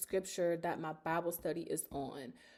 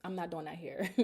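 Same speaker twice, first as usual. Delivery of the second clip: softer and lower, shutting it down; low determined no, fighting tears; quiet, firm refusal; 3 semitones lower, 8 dB quieter than usual. Real, not acted. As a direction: hold back tears, quietly. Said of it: "I'm gonna start crying".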